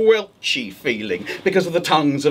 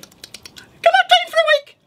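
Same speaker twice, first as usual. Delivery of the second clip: very high, louder squeaky rabbit; rabbit asking; 4 dB louder than usual. Acted. Rabbit at the witch's door, squeaky asking. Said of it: "the rabbit said".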